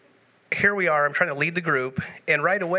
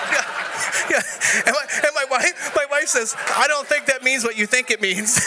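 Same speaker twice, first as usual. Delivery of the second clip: laughing; 10 semitones higher than usual. Real, not acted. Laughing while retelling wife.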